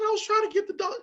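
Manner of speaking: very high-pitched tearful kid imitation; mock crying child. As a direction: whine it out as a kid in tears. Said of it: "I get up and I'm crying".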